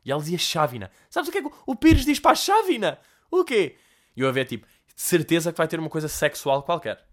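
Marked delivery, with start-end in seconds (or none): 1.1-3.8 high granny voice asking the riddle; granny setting up the joke; high mock-granny riddle; old woman voice. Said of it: "uma velha desdentada".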